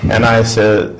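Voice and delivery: ordinary talk, nothing marked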